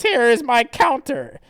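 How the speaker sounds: high, loud cartoon fighter; counter-attack cry. Fighter announces a counter, loud and high. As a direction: declare the move theatrically. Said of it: "And then it says".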